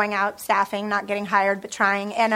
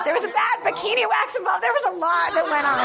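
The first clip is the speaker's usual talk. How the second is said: laughing; 7 semitones higher than usual. Real, not acted. Laughing about bad breakup.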